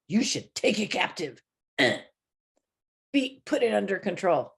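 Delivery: put-on stern lecture; mock scolding. Preacher acting what God doesn't say, mock-stern lecturing. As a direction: order them about in a changed voice. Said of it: "He doesn't do that".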